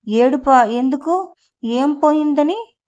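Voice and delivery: ordinary delivery, nothing marked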